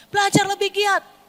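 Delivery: high-pitched parental order; parental scolding. Acted. Parent to child over falling grades, scolding. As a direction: push it high, nagging like a parent. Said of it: "langsungnya di biasanya".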